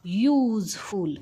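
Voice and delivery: युजफुल drawn out very slowly, starting with a y sound; slow model word. Shown as a demonstration. Teacher showing students the y sound, slowly. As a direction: stretch युजफुल so the y is heard. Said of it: "आता काय झालं बघा".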